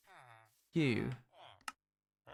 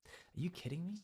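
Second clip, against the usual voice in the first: soft, rising disbelief; hushed, overacted A-level question; drama monologue; 4 semitones higher, 10 dB quieter than usual. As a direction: ask it quietly, lifting the pitch at the end. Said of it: "A-level drama".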